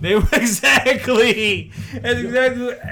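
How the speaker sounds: high, loud words breaking into laughter; cracking up. Real, not acted. Storyteller confirming his friend's guess about the roommates, cracking up. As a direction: agree helplessly through real laughter.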